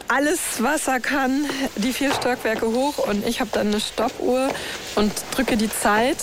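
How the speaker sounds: usual speaking voice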